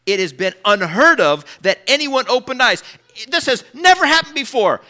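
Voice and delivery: loud and high, building passion; rising preacher's cry of wonder; preaching at full force. Real, not acted. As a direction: lift it into a proclaiming shout.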